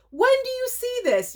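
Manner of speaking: very high thrilled shriek; high excited squeal. Real, not acted. Marvelling at the rare DVD to viewers, squealing. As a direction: squeal the question in delight. Repeated, much higher.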